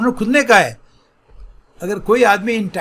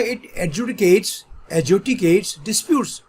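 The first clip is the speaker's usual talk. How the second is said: slow dictation; much slower than usual. Shown as a demonstration. Teacher dictating a new word.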